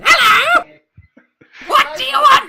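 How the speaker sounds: high, loud cartoon bellow; dopey cartoon bellow. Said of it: "I can't do booming voices".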